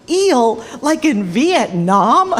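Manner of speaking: mock astonished repeat; incredulous echo. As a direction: throw it back in disbelief. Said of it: "put my face in his".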